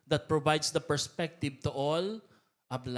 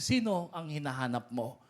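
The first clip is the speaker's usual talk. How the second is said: usual speaking voice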